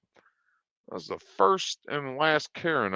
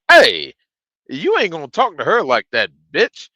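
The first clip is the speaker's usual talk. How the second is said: loud, higher confrontational call-out; acted-out scolding, raised voice; retold angry challenge; 7 semitones higher, 8 dB louder than usual. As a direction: re-enact stepping in to defend someone. Retelling own confrontation with customer.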